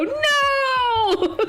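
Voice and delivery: high, wailing cry of no; mock-terrified wail; desperate no. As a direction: plead against it like your life depends on it. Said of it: "And I just go".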